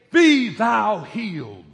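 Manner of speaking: loud, slow, churchy pronouncement; mock solemn. Pastor mocking a grand blessing, slow loud solemn intoning. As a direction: intone it like old pulpit English. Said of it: "Notice he didn't say".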